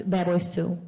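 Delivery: ordinary talk, nothing marked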